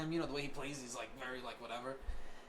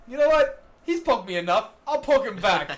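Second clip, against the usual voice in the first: loud, higher teasing declaration; mischievous resolve; 5 semitones higher, 11 dB louder than usual. Retold or imitated. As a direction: announce your revenge, playfully. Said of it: "I was like".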